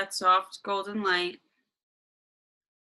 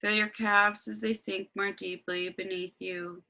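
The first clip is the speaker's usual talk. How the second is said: guided meditation. Acted.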